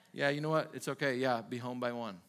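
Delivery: indulgent parent. Retold or imitated. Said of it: "favor changes rules".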